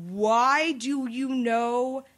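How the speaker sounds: very slow, word-by-word baffled question; dazed disbelief. Retold or imitated. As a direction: imitate stunned friends, stretching each word. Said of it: "their eyes are just glazed over".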